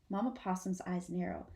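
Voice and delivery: ordinary delivery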